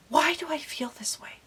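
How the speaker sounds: a hushed whisper to herself; lost, whispered self-question. Real, not acted. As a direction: breathe the question out, barely voiced. Asking herself in private despair.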